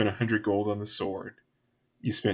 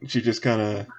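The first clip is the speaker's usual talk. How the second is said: ordinary talk, nothing marked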